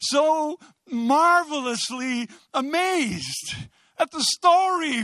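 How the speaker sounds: high, drawn-out amazement; marvelously amazed. Real, not acted. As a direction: stretch the words in excited wonder. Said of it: "I just get so, so marvelously amazed".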